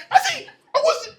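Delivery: breathless, high laughter; cracking up over the popcorn story; laughing. Real, not acted. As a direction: let the laugh spill into words.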